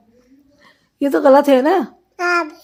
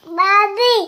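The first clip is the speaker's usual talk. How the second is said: high drawn call, rising at the end; 9 semitones higher, much slower than usual. Real, not acted. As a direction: call for someone in a high singsong. Child calling out on request. (ऊँची आवाज़ में पुकारना)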